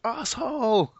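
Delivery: high-pitched mock yell; yelling in his face. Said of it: "yells in his face".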